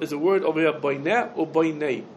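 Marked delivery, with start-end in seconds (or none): 0.7-1.3 Boineh ending in a short open "eh"; segol vowel. Shown as a demonstration. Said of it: "with a Segel or with a Tzerei". 1.5-2.0 Boinei ending in a gliding "ey"; tzerei vowel. Shown as a demonstration. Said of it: "with a Segel or with a Tzerei".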